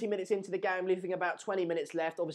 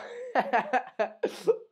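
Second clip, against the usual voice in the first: laugh; 6 semitones higher than usual. Real, not acted. Laugh after calling John.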